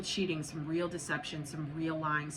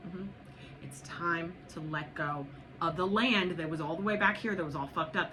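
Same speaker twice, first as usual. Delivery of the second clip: ordinary delivery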